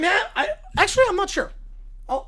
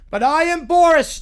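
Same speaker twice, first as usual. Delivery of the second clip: louder, proud repeat; louder insistence; 4 semitones higher, 7 dB louder than usual. Acted. Boris repeating his name to his son, louder. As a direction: insist even harder.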